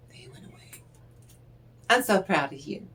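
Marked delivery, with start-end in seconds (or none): 0.1-0.8 breathy whispered repeat of "They went away"; hushed whispered repeat; whispered echo. Shown as a demonstration.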